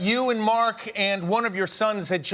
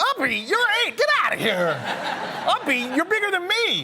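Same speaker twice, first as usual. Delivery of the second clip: high incredulous teasing; mock disbelief; 10 semitones higher than usual. Dad retelling his reply to his kids, laughing disbelief. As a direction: scoff at the request, laughing. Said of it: "And I'm like".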